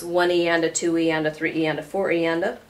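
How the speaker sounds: quick, running sixteenth count; counting "one E and a two E and a" fast in one breath. Shown as a demonstration. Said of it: "I'll say that slower".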